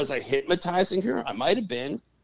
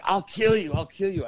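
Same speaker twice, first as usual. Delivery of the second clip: higher-pitched mock threat; threatening spirits; 7 semitones higher than usual. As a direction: imitate spirits coming at you with threats. Demons threaten him, acted out.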